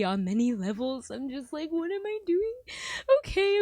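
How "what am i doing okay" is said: high, loud self-questioning; exasperated outburst. Real, not acted. Questioning her own worth, exasperated outburst. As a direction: burst out at yourself in distress.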